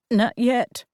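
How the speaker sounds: ordinary delivery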